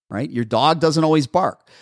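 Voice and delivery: usual speaking voice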